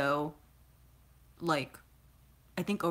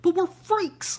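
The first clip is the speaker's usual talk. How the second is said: mocking high, loud whine of a quoted line; shrill mimicry of an overused catchphrase; Evan Peters impression; 13 semitones higher, 7 dB louder, slower than usual. Fed-up fan mocks Evan Peters' line, high and loud. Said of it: "if I hear Evan Peters say one more time".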